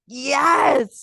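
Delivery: loud, high shouted exclamation; emphatic agreement. Retold or imitated. Viewers agreeing with her viral video, emphatic mock shout.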